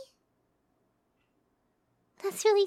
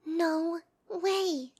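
usual speaking voice

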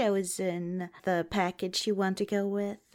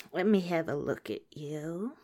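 ordinary talk

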